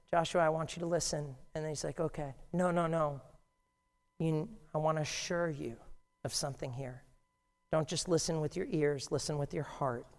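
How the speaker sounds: soft, gentle assuring voice; the angel softly reassuring a discouraged Joshua; solemn reassurance. Acted. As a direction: reassure him tenderly. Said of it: "solemnly assured Joshua".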